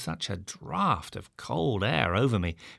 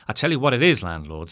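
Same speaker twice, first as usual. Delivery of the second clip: plain reading voice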